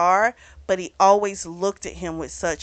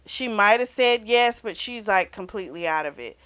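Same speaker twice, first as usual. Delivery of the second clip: ordinary delivery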